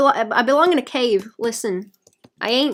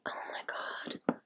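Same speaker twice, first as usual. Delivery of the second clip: despairing whisper; 16 dB quieter than usual. Real, not acted. Dismay at stream failure.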